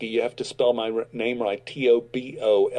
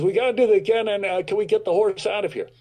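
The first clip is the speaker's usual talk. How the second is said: exasperated director; 7 semitones higher, faster than usual. Retold or imitated. Director asking to remove the horse, higher and exasperated. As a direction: ask to clear the horse, fed up. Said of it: "he says".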